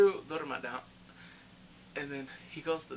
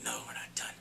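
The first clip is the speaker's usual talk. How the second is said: whispered menace; 6 dB quieter than usual. Retold or imitated. Retelling villain's reply.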